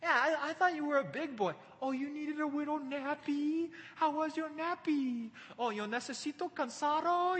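put-on high, babying singsong; mocking. David taunts Abner for napping on guard, mocking baby talk. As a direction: tease him as if cooing to a little child. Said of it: "he's just mocking him".

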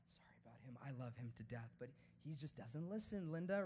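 low, hushed confidential aside; gracious apology. Acted. Preacher as Jesus excusing Peter to the wounded man, hushed apology. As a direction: apologise softly on a friend's behalf. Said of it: "he was more gracious with him".

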